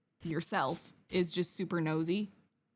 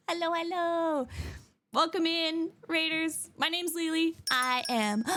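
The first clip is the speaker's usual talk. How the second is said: high, bubbly streamer greeting; bright high welcome voice; cute anime streamer; 7 semitones higher than usual. Real, not acted.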